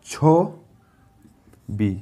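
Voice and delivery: very slow, stretched ছবি; drawn out. Shown as a demonstration. Teacher stretching ছবি to show the syllables. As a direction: hold ছবি long. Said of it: "কিন্তু আমরা বলি ছবি".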